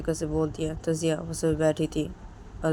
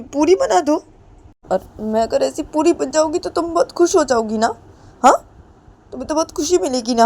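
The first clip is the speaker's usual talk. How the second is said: much higher and louder; bitter sarcastic taunt; 9 semitones higher, 8 dB louder than usual. Acted. Ritu sneering at Jiya, much higher louder sarcasm. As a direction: sneer at her.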